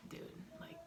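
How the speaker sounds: a whispered dude to his friend; whispered warning to a friend; hushed aside. Retold or imitated. Kalama quoted, hushed aside.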